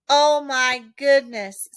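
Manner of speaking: a drawn-out gasp of dismay; the mother seeing mud pour from the cooler, dismayed. A narrator's reading. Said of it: "said the mother".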